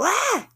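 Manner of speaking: sharp high yelp of sudden pain; imitated pain cry. Showing viewers how the stinging pains make her cry out, a sharp high yelp. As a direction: cry out as if stung by a sharp zap. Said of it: "makes me go".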